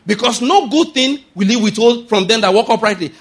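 preacher booming the promise; proclaimed aloud. Acted. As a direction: thunder the verse at the church. Scripture repeated with force.

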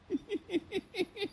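high mocking laugh. Mocking laugh at land sellers.

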